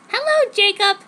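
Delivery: put-on high, bright girly greeting; chirpy hello. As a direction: sing out the hello to the gardener. Narrator greets the gardener.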